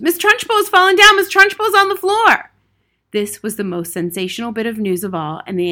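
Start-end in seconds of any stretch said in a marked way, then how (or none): 0.0-2.5 high, loud schoolboy scream; sustained high-pitched shout. A narrator's reading. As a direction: shriek the shocking news.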